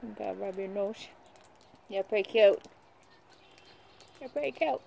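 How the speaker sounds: ordinary delivery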